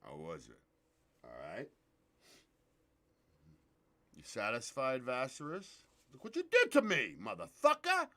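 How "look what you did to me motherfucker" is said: rising angry cry, pitch climbing higher; dramatic curse at the end of a scene; acted outburst. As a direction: hurl it at him like a wounded man.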